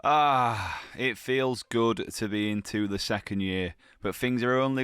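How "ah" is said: ordinary delivery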